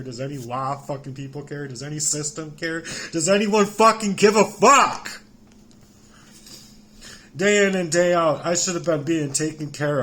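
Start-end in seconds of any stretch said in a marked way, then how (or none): 3.1-5.2 sudden yell, much higher and louder; anguished shout. Real, not acted. Begging anyone to care, anguished shout. Desperate outburst after quiet questions. 7.4-10.0 loud and higher, still heated; angry raised voice. Real, not acted. Still shouting about his neglect, angry raised voice. Outburst continues.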